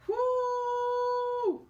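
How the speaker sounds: a high, loud laughing woo; excited whoop. Real, not acted. Tarot reader whooping to viewers over a card. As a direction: let out a gleeful whoop of delight.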